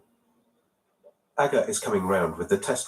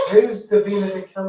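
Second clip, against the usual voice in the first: surprised; 8 semitones higher, 5 dB louder than usual. Real, not acted. Baffled by an unknown name.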